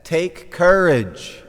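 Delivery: booming slow reassurance over the wind; Jesus calling out. Acted. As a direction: call it over the storm, drawn out. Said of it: "And he said".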